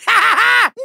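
evil cackle. Acted. Villain mocking the trapped Skylander, gloating cackle. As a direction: cackle in triumph. Villain laughs at own joke.

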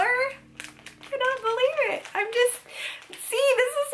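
high, gushing disbelief; excited gush. Real, not acted. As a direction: squeal it out in delight. Gushing over a dollar find.

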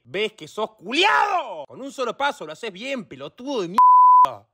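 scolding that builds to a loud curse; rant, then burst out on the insult; exasperated insult. Acted. Instructor berating a clumsy door-closer, exasperated.

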